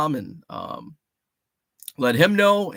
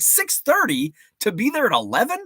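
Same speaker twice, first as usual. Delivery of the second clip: mock incredulous wife; 9 semitones higher than usual. Wife voiced in retold texts.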